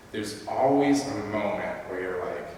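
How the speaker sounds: ordinary talk, nothing marked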